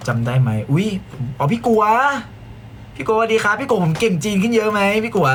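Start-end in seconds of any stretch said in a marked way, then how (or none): 1.4-5.3 high-pitched, eager hello; delighted, high questioning greeting; excited greeting. Real, not acted. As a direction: call out to a friend you just spotted. Spots and greets an acquaintance.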